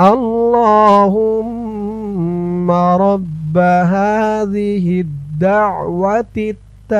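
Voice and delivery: slow melodic Arabic recitation. Shown as a demonstration. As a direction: chant the phrase slowly and sweetly. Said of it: "আমার সাথে বলুন". (ধীরে সুরেলা আরবি তিলাওয়াত)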